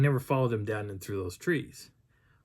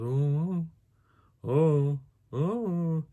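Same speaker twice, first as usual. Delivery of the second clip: repeated drawn-out hooting moans; imitated response; 4 semitones higher, much slower than usual. Narrator imitating the only response he got, hooted moans. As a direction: mimic an eerie wordless answer. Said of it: "The only response I got was".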